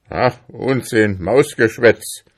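moderately loud, impatient outburst; shouted dismissal. A narrator's reading. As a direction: dismiss it with irritated force. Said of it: "schrie Ambrosius Dauerspeck".

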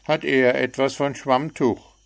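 a chirpy bird poking fun; cheeky little bird. A narrator's reading. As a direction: frecher kleiner Vogel. Bird teasing.

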